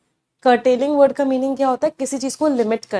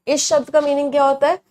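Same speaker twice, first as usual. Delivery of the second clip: usual speaking voice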